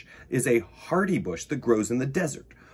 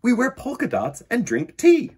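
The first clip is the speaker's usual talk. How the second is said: Jackie explaining; 10 semitones higher, 5 dB louder than usual. Acted. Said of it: "explained Jackie".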